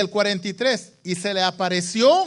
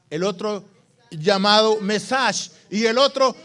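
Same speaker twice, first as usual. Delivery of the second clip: normal talking voice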